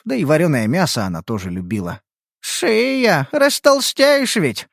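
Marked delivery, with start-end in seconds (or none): 2.4-4.7 high, slow teasing scold. Acted. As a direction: chide a friend, stretching it out. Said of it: "пожурила Иллититка напарницу".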